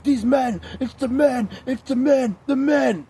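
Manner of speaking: high, loud mock of her ranting voice; high mocking imitation. Mocking her high ranting about men. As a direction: squeal it like a caricature of her. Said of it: "heard her be like".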